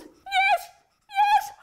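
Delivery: very high, loud cheer. Acted. Leader cheers for the healed men, high and loud.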